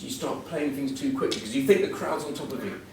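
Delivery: ordinary talk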